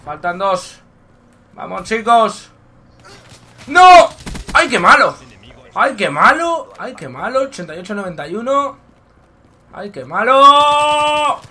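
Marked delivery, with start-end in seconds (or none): 3.7-4.1 loud high cry of dismay; anguished shout. Real, not acted. Just killed in game. 4.5-6.7 mocking. Real, not acted. Mocking the enemy. 9.7-11.4 slow high drawn-out taunt; mocking drawl. Real, not acted. Drawn-out mock.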